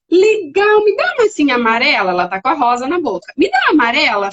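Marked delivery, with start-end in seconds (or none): 0.1-2.1 put-on higher, bright parent-to-child voice; upbeat playful request, pitched up; cheerful redirection. 3.4-4.3 higher, louder playful request; upbeat parent voice, acted; cheerful redirection. Demonstrating cheerful redirection of child.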